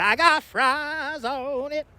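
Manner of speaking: high, slow, drawn-out fries line; hammed-up slow customer. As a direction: stretch it out like a goofy customer. Imitating Dairy Queen customers.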